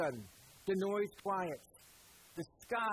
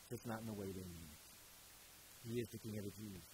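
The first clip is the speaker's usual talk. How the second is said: hushed reverence; 8 semitones lower, 11 dB quieter than usual. Acted. Preacher revealing the true king to congregation, hushed and low. Hushed truth after "understand this.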